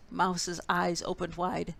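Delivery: usual speaking voice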